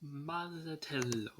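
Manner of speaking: put-on slow, drawn-out Italian accent on the name. Host tries Italian accent on the torture name Mazzatello. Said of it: "Sorry, that's my best Italian accent".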